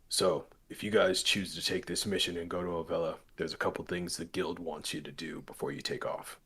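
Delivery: low, subdued douche voice; lightly voiced douche giving orders. Guild man briefs the group on the mission, low douche voice. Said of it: "You know a douche when you hear that voice".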